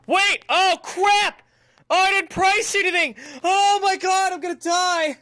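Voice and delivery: loud, high cry of alarm; panicked yelling. Real, not acted. Shop owner realises nothing is priced, panicked yelling. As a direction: blurt it high in sudden panic.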